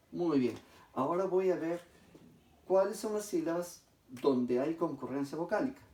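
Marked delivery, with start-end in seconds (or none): none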